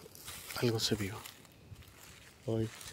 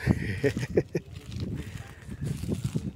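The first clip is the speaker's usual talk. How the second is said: breathy jittery laugh; nervous laugh. Real, not acted. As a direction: laugh from nerves. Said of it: "Ya no sé si me río de, de los nervios".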